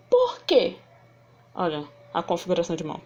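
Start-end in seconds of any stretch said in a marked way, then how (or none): 0.1-0.8 high-pitched questioning tone; emphatic teaching-demo question; demonstrated question. Shown as a demonstration. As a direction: ask it high, then let it fall. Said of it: "Essas são as interrogativas".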